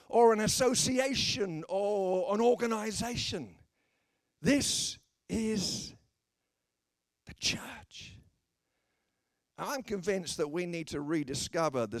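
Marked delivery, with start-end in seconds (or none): 4.4-8.2 hushed whisper, words set apart by long pauses; dramatic whisper. Real, not acted. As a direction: whisper it slowly as the big reveal. Dramatic climax of the point.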